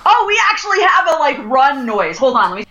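usual speaking voice

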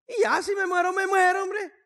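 high, loud shout; defiant shout. Real, not acted. Pastor telling the church he fears no death, shouting. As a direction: throw it out fearlessly. Defiant outburst about dying.

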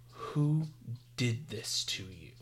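hushed, halting disbelief, imitated; stunned. Retelling Superman speechless at the de-Shazamed boy, softly stunned. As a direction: drop to a soft, shocked near-murmur with a gap. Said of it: "speechless. He's like".

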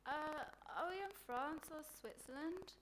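put-on clueless girl asking in earnest; dumb blonde impression. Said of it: "choice examples include".

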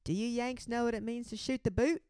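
high, lightly Aussie stage-announcer voice; mock Australian singer. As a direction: put on an Australian accent for the crowd. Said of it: "she goes".

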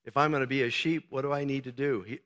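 normal talking voice

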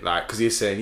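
ordinary delivery

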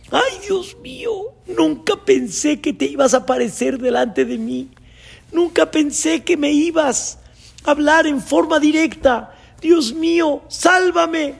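very high, wailing plea; hammed-up panicked climber, voice way up; desperate pleading. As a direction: beg frantically to be saved.

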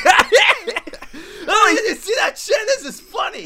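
very high, loud laughing shout; laughing fat guy. Retold or imitated. Popcorn guy beside weeper, laughing shout at the screen. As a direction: cackle it through the laugh like a clueless moviegoer.

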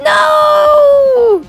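high shouted no; loud protest. Real, not acted. Cohost protesting the Little Rascals cancellation, shouted no. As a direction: yelp the no in dismay.

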